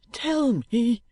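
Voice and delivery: put-on high, pressing boy's voice; urging boy. A narrator's reading. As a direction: push him for an answer. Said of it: "urged Smike".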